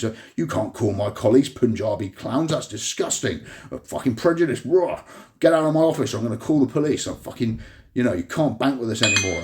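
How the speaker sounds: high, loud, hammed-up indignant bank manager; mock outraged manager. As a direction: throw him out of the office in mock fury. Manager's outraged reply, retold quarrel.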